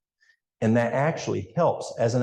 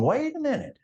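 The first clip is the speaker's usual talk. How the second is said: high squeaky cartoon voice for the liver; a little organ crying foul, pitched way up; liver protesting. The liver objecting to a flood of waste, in a squeaky cartoon voice. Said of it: "and the liver's going".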